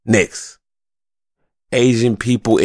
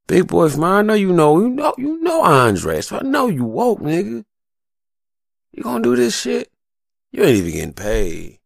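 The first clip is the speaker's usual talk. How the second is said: raised, pointed calling-out voice, higher pitch; mock challenge to Big Boy; 9 semitones higher than usual. Speaker confronting Big Boy over the show, higher challenging voice. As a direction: needle him like you're calling him out. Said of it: "and I'm like".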